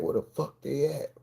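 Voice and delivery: urgent whisper, no voice; panicked whisper. Retold or imitated. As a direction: hiss the question out in panic. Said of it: "I started panicking".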